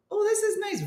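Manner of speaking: very high, light coo; high-pitched sing-song pleasure; pleased pedalling. Retold or imitated. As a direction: lift it way up, mock contentment. Acts out brief relief while pedalling.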